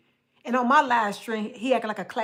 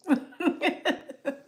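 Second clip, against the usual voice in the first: giggle; 5 semitones higher than usual. Real, not acted. Laughing at own joke.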